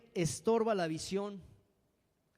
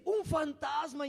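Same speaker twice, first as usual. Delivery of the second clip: mock frightened cry; 10 semitones higher, slower than usual. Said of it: "dieron voces de miedo".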